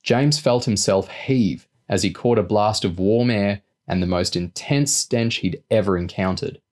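ordinary delivery

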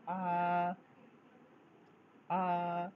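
flat tone on आ; Chinese first tone. Shown as a demonstration. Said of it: "तो ए को बोलेंगे हम लोग".

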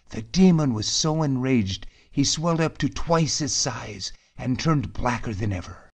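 plain reading voice

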